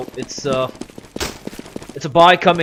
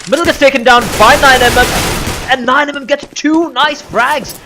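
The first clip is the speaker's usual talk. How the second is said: esports caster; 6 semitones higher, 6 dB louder than usual. Acted. Live play-by-play rising tension.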